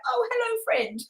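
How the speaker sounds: high jolt on hello, dropping on friend; mock surprise. Opening an old handbag to find a spider, mock surprise. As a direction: greet the spider with startled irony. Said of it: "you're like".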